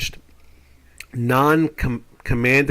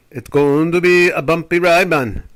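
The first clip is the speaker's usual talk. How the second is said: put-on Jamaican accent; 4 semitones higher, 5 dB louder than usual. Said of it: "someone from Jamaica".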